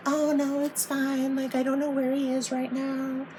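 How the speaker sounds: high, breezy fake-innocent sister; mocking sister voice. Mocking sister's earlier brush-off.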